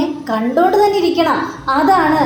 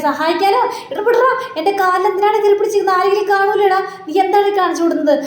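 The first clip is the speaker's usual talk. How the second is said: high, fast, exasperated scolding; angry scolding mother; 7 semitones higher, faster than usual. Acted. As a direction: snap at the son to let go, rapid and high. Mother scolds son clutching her leg. (ഉയർന്ന ശബ്ദത്തിൽ വേഗത്തിലുള്ള ദേഷ്യത്തോടെയുള്ള ശകാരം)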